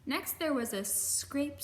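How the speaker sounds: plain reading voice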